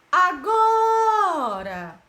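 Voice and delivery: "agora" chanted high and loud, GO held very long; slow, high call of "agora" with a stretched GO; stress on penultimate syllable. Shown as a demonstration. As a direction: sing out the "go" of "agora". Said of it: "a sílaba mais forte é a penúltima".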